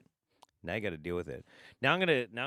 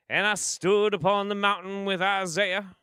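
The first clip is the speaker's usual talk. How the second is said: Baptist preacher; 8 semitones higher, 6 dB louder than usual. Shown as a demonstration. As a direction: preach it like an evangelical pastor from the pulpit. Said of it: "I'll give you an example".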